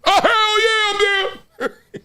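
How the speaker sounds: high, slow, laughing hell yeah; overjoyed, can't believe it; giddy squeal of agreement; thrilled yes. Retold or imitated. Imagined eager reply to Obama.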